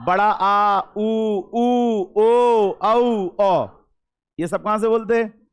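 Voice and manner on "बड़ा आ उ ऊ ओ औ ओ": बड़ा आ, उ, ऊ, ओ, औ, ओ recited as a list from the back of the tongue; back vowels. Shown as a demonstration. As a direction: sound out बड़ा आ, उ, ऊ, ओ, औ, ओ from the back, raised pitch. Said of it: "पश्च से बचे कुछ". (बड़ा आ, उ, ऊ, ओ, औ, ओ पीछे से)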